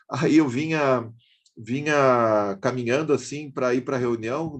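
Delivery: usual speaking voice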